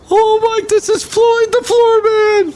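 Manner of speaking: very high, squeaky character voice; silly high voice. Acted. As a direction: pitch way up like a cartoon sidekick.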